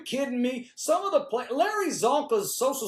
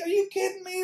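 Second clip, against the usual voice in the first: much higher, mock disbelief; incredulous; 11 semitones higher, faster than usual. Real, not acted. Bragging to the audience about the bookie money, incredulous. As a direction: squeak it out in amazement. Disbelief at his betting wins.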